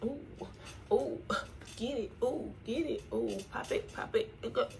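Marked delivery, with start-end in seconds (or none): none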